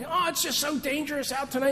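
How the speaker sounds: high, fast mock-worried mother; hammed-up worried wife; fretful wife. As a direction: fuss anxiously like a nervous mom. Wife voiced in retold story.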